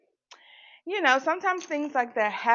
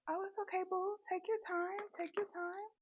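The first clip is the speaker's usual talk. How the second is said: sweet reassurance; 10 semitones higher, 13 dB quieter than usual. Retold or imitated. Soothing a panicking cashier.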